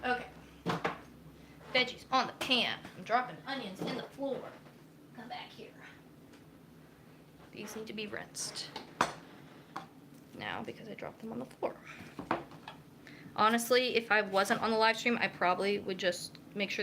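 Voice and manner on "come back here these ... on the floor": whispered under breath; whispering. Real, not acted. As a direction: whisper it to yourself. Talking to dropped onions.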